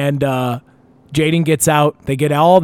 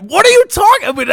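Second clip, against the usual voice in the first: incredulous; 5 dB louder than usual. Retold or imitated. Incredulous reply to friend.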